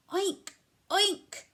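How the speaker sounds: high loud oinks; pig oink. Pretend. Animal sounds for children, high loud pig oinks. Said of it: "make the sounds that the animals make".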